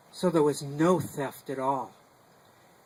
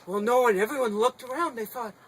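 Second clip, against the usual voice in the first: usual speaking voice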